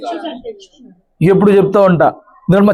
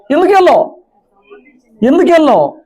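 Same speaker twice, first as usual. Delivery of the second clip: demanding pastor; 8 semitones higher than usual. Acted. Pastor demands spirit leave.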